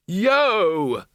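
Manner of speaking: high, long whoop; big drawn-out holler; bellowed. A narrator's reading. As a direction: bellow it out over a crowd. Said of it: "bellowed Ridcully".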